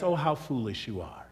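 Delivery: soft, low, gentle chiding; gentle rebuke. Acted. Jesus gently chiding the two disciples, soft and low. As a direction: chide them tenderly, barely above a murmur. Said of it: "He just simply gently says".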